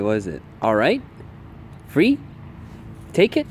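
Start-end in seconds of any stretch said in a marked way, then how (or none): 1.9-3.5 bright high command to the dog; high release command. Real, not acted. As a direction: call the release word up high.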